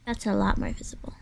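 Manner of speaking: quiet whisper to self. Real, not acted. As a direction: whisper it under breath. Whispering to herself.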